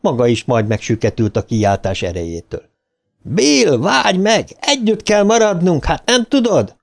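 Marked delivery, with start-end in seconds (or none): 3.2-6.8 high-pitched pleading shout; urgent holler, pitched high throughout; calling out urgently. A narrator's reading. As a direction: call after someone leaving you behind. Shouting after friend in the pipe.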